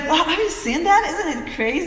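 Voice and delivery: excited, high and loud; amazed. Retold or imitated. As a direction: gush with amazement. Retells her own amazed reaction.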